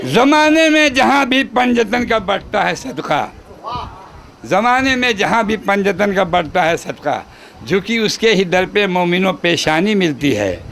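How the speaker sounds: measured, projected mushaira-style recital; poem recitation. Acted. Poet reciting praise verse to gathering, declaimed. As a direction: declaim the couplet, lingering on line endings.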